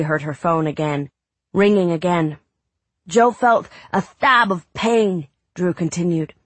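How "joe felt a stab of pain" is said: put-on high excited boy reading aloud; a kid acting out a pirate tale; boy reading dramatically.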